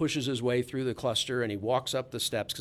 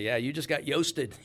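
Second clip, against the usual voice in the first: teasing line dissolving into a laugh; 4 semitones higher, 4 dB louder than usual. Real, not acted. Reporters teasing each other, laughing. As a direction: crack up at the joke.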